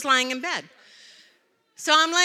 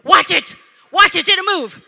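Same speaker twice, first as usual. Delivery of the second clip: high, loud frantic shout; imitated yelling; 5 semitones higher, 9 dB louder than usual. Pastor checking on spider while fetching vacuum, mock frantic shout. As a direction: shout it like a panicking husband. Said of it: "the whole time he's going".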